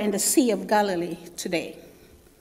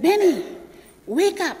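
higher, calling out Benny; dad calling; 5 semitones higher than usual. Retold or imitated. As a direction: wake up the sleepwalking son. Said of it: "I heard my dad said".

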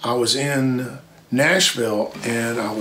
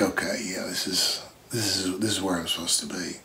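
quiet inner thought; 4 semitones lower, 8 dB quieter than usual. Retold or imitated. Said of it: "I was like".

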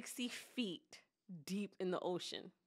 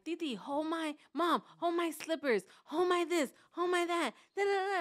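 put-on higher, louder whiny kids' demands; kids nagging; 7 semitones higher, 4 dB louder than usual. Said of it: "The kids are like".

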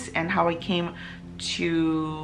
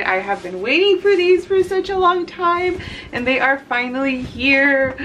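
excited; 6 semitones higher, 5 dB louder than usual. Real, not acted. Unboxing her first book.

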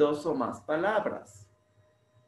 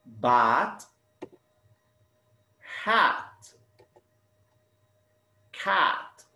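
bat, hat, cat, isolated with long pauses; highlighted rhyme; 2 semitones lower, much slower than usual. Shown as a demonstration. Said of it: "Do you notice the rhyme?".

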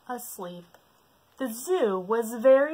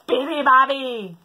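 slow, loud roared call; 6 semitones higher, 10 dB louder than usual. Acted. Lion wakes the bird, loud roar. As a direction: roar it long. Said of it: "roared the lion".

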